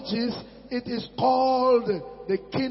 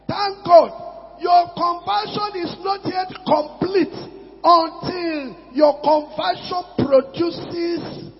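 loud, higher, slowing preacher's cry; preaching shout; 5 semitones higher, 4 dB louder, slower than usual. Real, not acted. Preacher exhorting his church, loud fervent shout.

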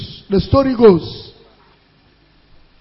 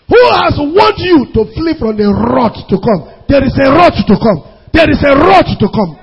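raised warning from the pulpit; fiery preaching; 3 semitones higher, 5 dB louder than usual. Real, not acted. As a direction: hammer out the warning, punching key words.

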